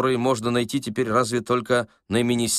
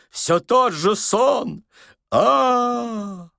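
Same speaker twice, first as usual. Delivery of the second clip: high, loud, slow tragic cry; operatic wail; 12 semitones higher, 4 dB louder, much slower than usual. A narrator's reading. Old man haunted by the same dream, operatic wailing. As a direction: end in a long sustained howl. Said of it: "с шаляпинскими интонациями в голосе".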